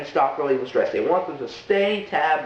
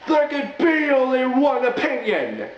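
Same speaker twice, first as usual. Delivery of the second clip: booming movie-trailer announcer voice, high and far off; mock announcer; 7 semitones higher than usual. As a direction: announce it like a dramatic title card. Mock dramatic announcer title.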